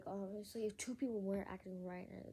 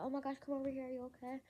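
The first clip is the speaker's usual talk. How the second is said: higher-pitched retold alarm; concerned sister; 6 semitones higher, faster than usual. Sister calling over a crying sibling, concerned. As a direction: imitate a sister's worried call.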